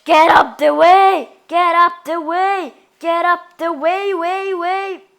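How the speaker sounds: loud slow English yell; fighting game announcer. Acted. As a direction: boom it out like an arena announcer.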